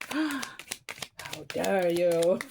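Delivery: very slow, mock-indignant; hammed-up outrage at a tarot card; mock offended. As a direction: play scandalised, drawing it out. Mock offence after the gasp.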